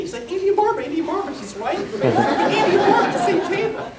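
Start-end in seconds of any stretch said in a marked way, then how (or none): 0.3-1.3 high, loud, gushing imitation of his wife; imitating an excited wife; giddy excitement. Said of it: "she got all giddy". 1.8-3.9 high, loud, gushing imitation of his wife; imitating an excited wife; giddy excitement. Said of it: "She was all excited".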